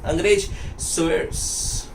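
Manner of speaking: अंग्रेज स्विस said in a clipped English style, like "Swiss"; English-style स्विस for Sèvres; English way of saying Sèvres. Shown as a demonstration. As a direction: voice how an Englishman says स्विस. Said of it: "बहुत अलग-अलग तरह से बोलते हैं".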